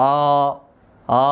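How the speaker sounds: অ as a long, rounded back 'aw'; isolated heavy long vowel; heavy alif sound. Shown as a demonstration. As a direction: hold a thick, dark 'aw' steadily. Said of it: "এই উচ্চারণটাই হচ্ছে আলিফের উচ্চারণ".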